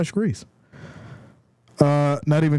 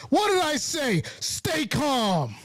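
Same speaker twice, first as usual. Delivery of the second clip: vindicated scolding; 7 semitones higher, slower than usual. Real, not acted. Scolding the worried fans after scoring.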